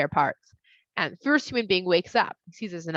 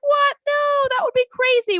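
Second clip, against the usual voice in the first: much higher and louder disbelief; shocked woman; 8 dB louder than usual. Acted. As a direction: gasp out a shocked denial. Woman shocked at snake's claim.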